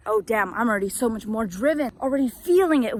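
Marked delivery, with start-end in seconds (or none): none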